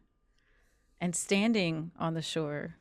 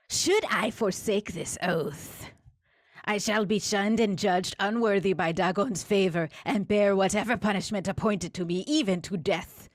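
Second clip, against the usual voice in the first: tinny guttural creature dictating; 3 semitones higher, 4 dB louder than usual. Acted.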